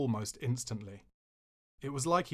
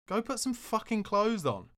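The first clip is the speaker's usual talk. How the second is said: angry yell; 9 semitones higher than usual. Retold or imitated. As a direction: bark the order at him. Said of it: "I yelled".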